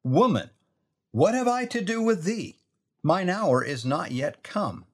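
Jesus sounding short with his mother; curt, dismissive rebuke. Shown as a demonstration. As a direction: pitch jumps high on have, brushing her off. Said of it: "Listen to that again".

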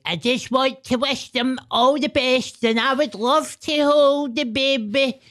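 high-pitched, slow crackpot voice; oddball royal well-wisher. Acted. Mocking an oddball at the palace gates, high drawn-out crackpot voice. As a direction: draw it out in a silly high put-on voice. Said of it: "there was a guy outside, like".

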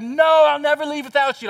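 mock melodrama, she won't leave him; wife's dramatic refusal. Wife refuses to leave her husband, hammed-up and loud.

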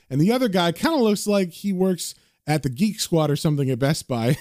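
usual speaking voice

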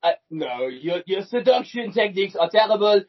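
scolding French agent; hammed-up verdict in a mock French accent; Melanie. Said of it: "Should I be Melanie?".

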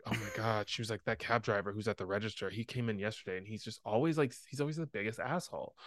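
imitating a boss's low, hushed complaint; confiding gripe. Boss griping to coworker about a cab driver, hushed. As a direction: grumble under your voice so the customer can't hear.

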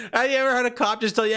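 high burst of laughter running into a fast question; laughing. Real, not acted. Laughing at the absurd memory while asking his viewers. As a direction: laugh it out, then rush the question.

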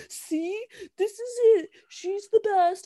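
high-pitched mock superfan voice; gushing fans. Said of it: "stans are gonna be like".

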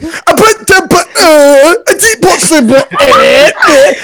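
slow, high, halting caricature of himself; mocking imitation. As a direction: exaggerate the stumbling grunts they pinned on you. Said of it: "You're making me speak like this".

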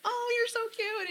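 very high-pitched gushing coo; cooing at children. Acted. Adult greeting little children, high-pitched cooing.

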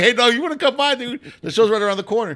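high, loud, hammed-up buddy voice; mock friendly invite. Comic telling the cop to come to the show, mock buddy voice. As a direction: hype the cop up like an old pal.

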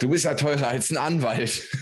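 imitated teasing client. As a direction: quote a client's cheeky joke, amused. Retelling client's joke, then laughs.

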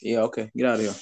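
ordinary talk

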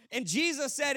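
ordinary talk

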